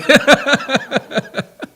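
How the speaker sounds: big real laugh, high and loud; hearty laughter. Real, not acted. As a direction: burst out laughing freely. Amused by congregation's reaction.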